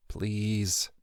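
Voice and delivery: hushed, pleading inner voice; a soft desperate prayer; quiet plea. Acted. Inner plea, fearing too late.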